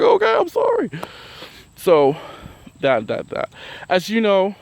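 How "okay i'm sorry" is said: very high, small sorry voice; meek apology. Retold or imitated. Her apologizing to a furious coworker, tiny and high. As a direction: shrink and squeak the apology.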